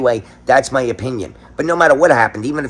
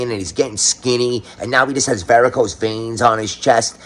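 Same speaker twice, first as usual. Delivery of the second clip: ordinary talk, nothing marked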